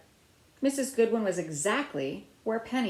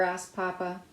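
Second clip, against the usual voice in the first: ordinary delivery, nothing marked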